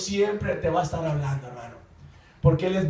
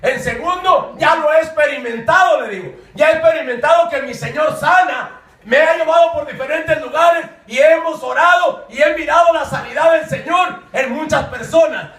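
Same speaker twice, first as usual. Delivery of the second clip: raised, emphatic declaration of faith; higher, pressing sermon voice; fervent preaching; 7 semitones higher, 7 dB louder than usual. Retold or imitated. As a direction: proclaim the promise with conviction. Preaches his retold reply.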